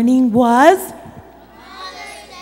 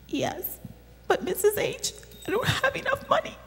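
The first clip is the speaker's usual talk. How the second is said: put-on high, soft, fast child's voice; little girl voice; 11 semitones higher, 9 dB quieter than usual. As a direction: play the worried little girl. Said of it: "And she said".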